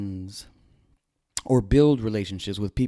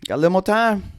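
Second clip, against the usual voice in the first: higher and louder, elated; louder, higher burst of glad relief; excited relief; 8 semitones higher, 7 dB louder than usual. Retold or imitated. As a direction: celebrate the reprieve out loud. Waking relieved from nightmare.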